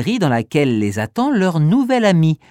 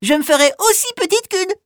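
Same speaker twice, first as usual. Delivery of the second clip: high, fast squeaky mouse promise; little mouse voice; faster than usual. Acted.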